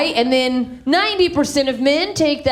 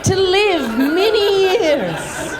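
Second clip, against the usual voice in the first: mock tender rescue; 4 semitones higher than usual. Explaining to LA people, mock-sweet nature lover. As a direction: coo it like a naive nature lover. Mocking gentle LA snake rescue.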